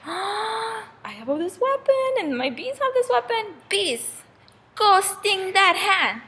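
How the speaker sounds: high, drawn-out realization turning into an order; rally the troops, high and stretched; dramatic command. Acted. Queen bee sending bees to sting, dramatic command.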